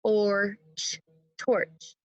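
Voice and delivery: torch tapped as Or, ch, then torch; sound-by-sound tapping of torch; segmented sounds. Shown as a demonstration. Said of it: "Here we go".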